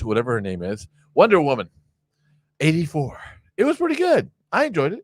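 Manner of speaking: ordinary delivery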